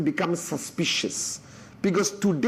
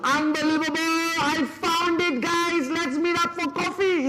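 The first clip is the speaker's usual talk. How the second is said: over-excited invite; 11 semitones higher than usual. Shown as a demonstration. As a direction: ham up a desperate, thrilled caller. Mocking an over-eager desperate invite.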